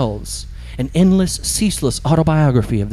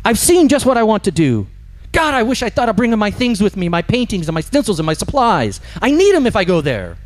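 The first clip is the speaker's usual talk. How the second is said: high, pushy complaining; irritated ghost; 7 semitones higher than usual. Acted. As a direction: grumble at the spirit, pitched up. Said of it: "they're always angry".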